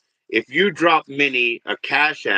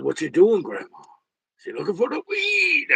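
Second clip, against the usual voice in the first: punchline squeaked much higher; mock grandma; 10 semitones higher than usual. Shown as a demonstration. Comic demonstrating his joke, high granny voice. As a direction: squeak the last line like a granny. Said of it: "I said".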